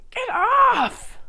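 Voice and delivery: high yelping Get off; playful yelp. Real, not acted. Vlogger yelps at tomato stuck to her knife, playful.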